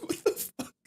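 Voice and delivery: soft, high squeak of retold shock; breath-starved mock disbelief; stunned disbelief. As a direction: squeeze it out while cracking up. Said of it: "I was like".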